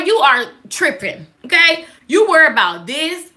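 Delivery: usual speaking voice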